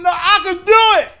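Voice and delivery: put-on insistent kid. Kid refusing help to prove himself, loud insistent cry. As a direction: push back like a kid who must prove himself. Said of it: "that mentally challenged kid that gotta prove himself".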